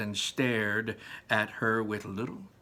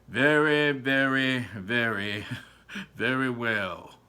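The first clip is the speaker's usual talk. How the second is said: pompous father; 4 dB louder, slower than usual. Acted. The father steps forward pompously.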